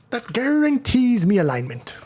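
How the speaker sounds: badly faked Irish accent, pitched high. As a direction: attempt a hammed-up Irish lilt and botch it. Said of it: "horrible attempt at an Irish accent".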